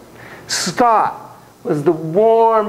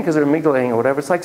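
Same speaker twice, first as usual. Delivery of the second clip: normal talking voice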